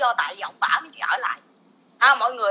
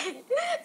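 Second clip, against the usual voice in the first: high burst of laughter; 12 semitones higher, 8 dB quieter than usual. Real, not acted. Laughs mid rant.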